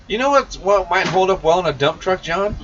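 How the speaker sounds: usual speaking voice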